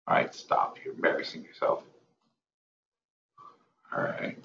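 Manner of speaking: whispered self-scolding under the breath. Real, not acted. Trader at his screen whispering a scolding to himself. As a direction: hush yourself, scold yourself softly.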